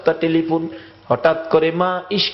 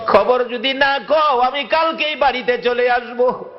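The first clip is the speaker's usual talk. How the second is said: desperate plea; 8 semitones higher than usual. Acted. Son begs brother on phone.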